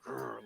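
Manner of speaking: a soft little mock growl at a rival; half-hearted growl, under the breath. Guest retelling a friend's glare, soft mock growl. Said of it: "turned his hat backwards and was like".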